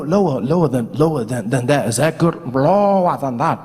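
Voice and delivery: from a squeaky voice sinking to a low bass. Shown as a demonstration. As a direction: slide the voice down step by step. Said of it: "Low, lower, lower, way down".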